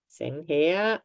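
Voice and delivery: ordinary talk, nothing marked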